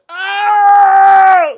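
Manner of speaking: long, high, very loud scream. Acted. Inmate screaming somewhere in the prison, loud high scream. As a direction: let out a drawn-out piercing yell. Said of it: "This motherfucker screaming in this bitch".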